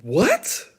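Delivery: high, loud shocked "What?". Retold or imitated. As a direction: blurt it out in disbelief. Shocked by the offer.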